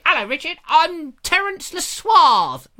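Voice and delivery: high, loud, slow introduction; mock fan greeting. Fan greeting the host, high slow mock introduction. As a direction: introduce yourself with exaggerated suavity. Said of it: "when you say".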